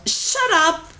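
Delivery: put-on a prince yelling back at the girl; yelled back. A narrator's reading. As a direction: yell back at her. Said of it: "the prince yelled back".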